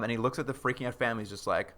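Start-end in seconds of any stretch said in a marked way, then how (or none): none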